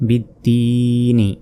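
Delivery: very slow "বিদ্দিনি" with a long middle "দি"; one-alif stretch. Shown as a demonstration. Qur'an teacher joining the syllables, middle vowel stretched. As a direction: hold the "দি" steady, then a short "নি". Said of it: "এক আলিফ টান হবে".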